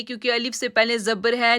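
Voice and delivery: usual speaking voice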